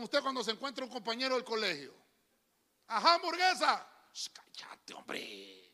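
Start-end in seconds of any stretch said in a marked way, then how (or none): 2.9-3.9 loud, higher mocking call of a nickname; old school friend teasing loudly; teasing classmate. 4.1-5.7 hushed whisper, begging him to stop; whispered plea to the classmate; embarrassed whisper. Retold or imitated.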